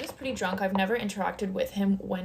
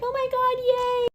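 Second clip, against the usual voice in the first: much higher, squealing yay; louder and much higher, a hammed-up cheer; mock scream; 3 dB louder than usual. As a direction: shriek it like excited middle schoolers. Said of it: "screaming and being like".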